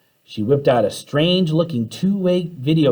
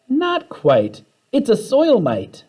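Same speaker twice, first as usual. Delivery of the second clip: a lightly voiced cheerful teacher, higher; 11 semitones higher, 3 dB louder, slower than usual. Teacher calmly correcting a frightened student, cheerful. Frizzle answering monster fear.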